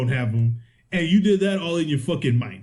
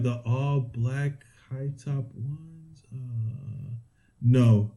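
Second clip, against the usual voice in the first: slow, low, spacey mock salesgirl voice; clueless salesgirl; 5 semitones lower, 4 dB quieter, much slower than usual. Retelling the shoe store clerk's reply, spacey and slow. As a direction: play dumb like a lost clerk, drawn out. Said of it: "because she's all like".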